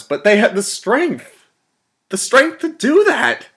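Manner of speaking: high, loud, slow emphatic marvelling; impassioned awe. Acted. Colonel marvelling at his enemies' strength, impassioned awe.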